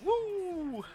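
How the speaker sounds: squealed high woo; high cheer. Real, not acted. Friends cheering the end of episode two, high and playful. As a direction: cheer like the episode was a triumph.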